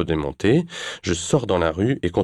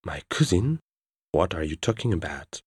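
puzzled English reply; speaking English; 4 dB quieter than usual. Acted. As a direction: switch to English, confused. Heroine answers in English.

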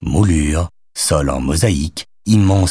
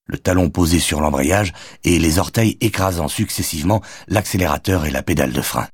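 usual speaking voice

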